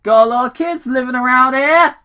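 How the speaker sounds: loud mock-stern cop; calling out, lightly scolding. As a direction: call after a speeder. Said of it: "Tom called out".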